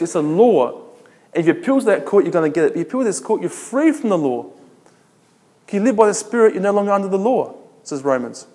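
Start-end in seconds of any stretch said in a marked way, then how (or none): none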